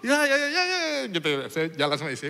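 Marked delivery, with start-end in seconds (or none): 0.0-0.8 grateful mock voice. Mute man thanks him.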